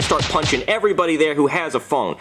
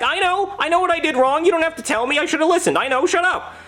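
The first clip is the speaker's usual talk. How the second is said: much higher, furious outburst; angry yell; 13 semitones higher than usual. Retold or imitated. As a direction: snap back at your wife in a rage. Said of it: "I'm like".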